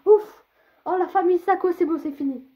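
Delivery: loud, high relieved exclamation; relieved outburst. Real, not acted. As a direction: burst out in relief. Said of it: "j'en pouvais plus".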